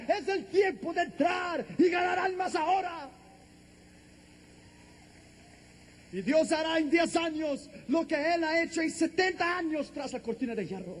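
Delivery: high, driving shouted proclamation; full-force preaching. Real, not acted. Preacher rallying the church to win souls now, full-force. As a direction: pile up the phrases in a sustained high cry.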